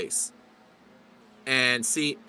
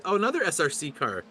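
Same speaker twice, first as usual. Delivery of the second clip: ordinary talk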